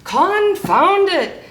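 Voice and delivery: high, loud, drawn-out exclamation; pirate shout. A narrator's reading. Pete loses patience with rascal, exasperated high shout. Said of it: "shouted Pete".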